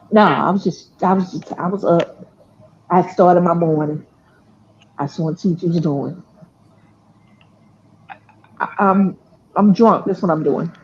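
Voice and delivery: imitated low, soft phone voice; low morning mumble. Drunk grandchild just home answering grandmother's call, low morning voice.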